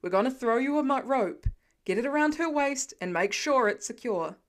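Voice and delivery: fast, loud firefighter orders; seasoned firefighter shouting rapid commands, loud and fast. Acted. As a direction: bark instructions up to him quickly.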